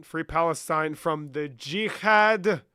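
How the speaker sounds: slow, loud put-on Israeli accent, shouted on the anti-Semites; a first rough stab at an Israeli accent; mock Israeli accent attempt.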